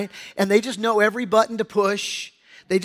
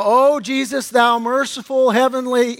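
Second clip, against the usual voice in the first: louder, grandly formal invocation; hammed-up churchy address; mock pious prayer; 3 semitones higher, 6 dB louder than usual. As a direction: parody a proper, lofty prayer. Mocks an overly proper prayer.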